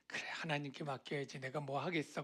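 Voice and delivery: soft, quick, resigned mumble to oneself; hands-off resignation. Acted. Bystander leaving the matter to God, hands-off resignation. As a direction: quietly give up and leave it be. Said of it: "이렇게 좀 방관하는 자세도 있고요".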